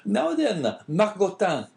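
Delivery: high, loud call to a dog; crying out. A narrator's reading. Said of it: "cried he, speaking to the dog".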